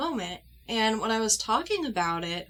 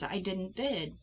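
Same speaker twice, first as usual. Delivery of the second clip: much softer, hollow admission; frozen in shock; 8 dB quieter, slower than usual. Retold or imitated. Admitting to her husband she froze, soft and stunned. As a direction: barely get it out, dazed. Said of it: "I froze".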